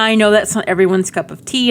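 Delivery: ordinary talk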